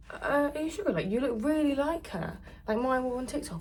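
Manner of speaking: high-pitched, disbelieving mimicry; imitating insistent fan. Retelling boy insisting it's her.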